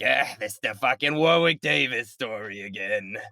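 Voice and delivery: slow, put-upon grumble; mock exasperated. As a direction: groan it like a bored friend. Voicing bored listener.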